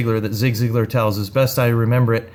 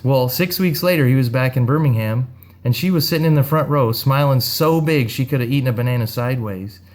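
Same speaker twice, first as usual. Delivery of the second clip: normal talking voice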